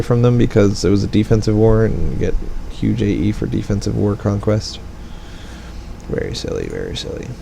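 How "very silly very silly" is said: much lower and softer, a mock-solemn mutter; low silly voice. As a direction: grumble it deep like a grumpy old man.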